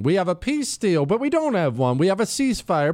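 ordinary delivery

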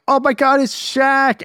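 high-pitched imitation of starstruck children; excited kids. Children running up to a celebrity, high excited voice. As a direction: squeal it like kids spotting a star. Kids spotting Shaq, acted.

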